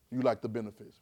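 normal talking voice

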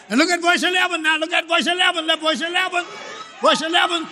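high, fast, driving preacher's build; fiery preaching. Acted. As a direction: pile it up and push the church forward.

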